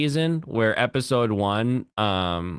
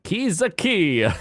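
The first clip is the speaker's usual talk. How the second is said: drawn-out, high Italian-style call; mock Italian; 10 semitones higher, slower than usual. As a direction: stretch the name in a cartoon Italian accent. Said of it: "If you're Italian, you say it".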